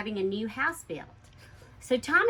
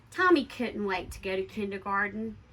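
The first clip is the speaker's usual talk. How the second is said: usual speaking voice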